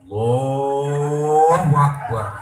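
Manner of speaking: very slow, loud, stretched takbir; martial-arts takbir. Retold or imitated. Preacher mocking a worshipper's kung-fu style takbir. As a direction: bellow it like a martial-arts shout. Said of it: "ada yang waring tenaga dalam".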